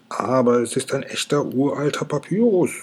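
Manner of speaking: ordinary delivery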